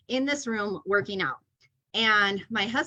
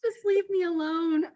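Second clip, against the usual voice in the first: high, drawn-out mock whine; slow, pitched-up comic begging; plaintive plea; 9 semitones higher than usual. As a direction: beg to be left in peace, hammed up. Ends the retold plea.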